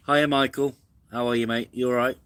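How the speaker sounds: cheerful loud hello to a viewer; bright live greeting. Real, not acted.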